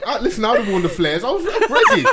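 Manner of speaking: laughing, high bragging; laughing boast. Real, not acted. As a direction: brag through laughter.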